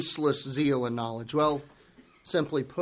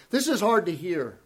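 ordinary talk, nothing marked

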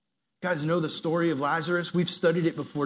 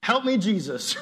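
mild mock holler; 5 semitones higher, 4 dB louder than usual. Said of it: "You just holler out".

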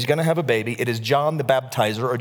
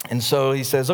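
normal talking voice

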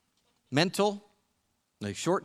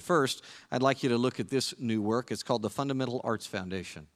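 ordinary delivery

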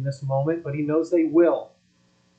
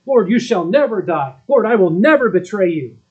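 Peter's fervent vow; 4 semitones higher, 7 dB louder than usual. Retold or imitated. Peter's vow, voiced in sermon.

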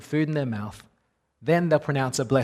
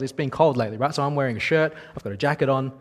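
ordinary delivery

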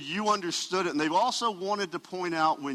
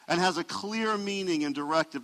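normal talking voice

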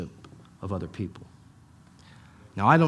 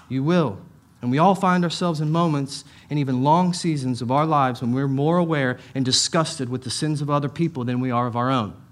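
normal talking voice

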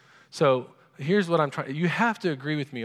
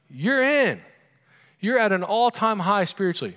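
excited praise; 7 semitones higher, 5 dB louder than usual. Retold or imitated. Voicing approval to Peter.

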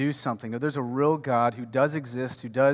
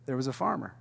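quiet storytelling Jesus; 2 semitones lower, 8 dB quieter, faster than usual. Retold or imitated. Jesus addressing crowds, quiet understated storytelling. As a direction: drop the voice as if starting a parable. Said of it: "he walks up and he says".

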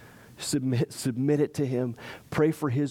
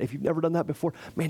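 ordinary talk

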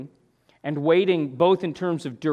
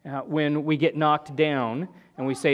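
ordinary talk

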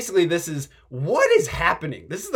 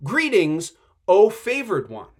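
loud proclaimed greeting; 3 semitones higher, 6 dB louder than usual. Acted. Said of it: "here's the word".

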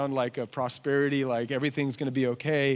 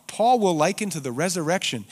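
normal talking voice

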